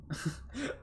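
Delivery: high soft villain chuckle; a sly little laugh; sinister chuckle. Acted. The Professor chuckles at the girls.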